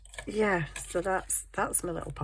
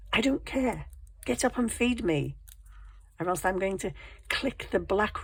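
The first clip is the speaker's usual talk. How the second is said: high-pitched, insistent pet giving orders; demanding cat; 5 semitones higher than usual. Acted. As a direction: order your owner about like a bossy cat.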